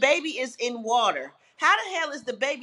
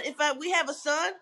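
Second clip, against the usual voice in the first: usual speaking voice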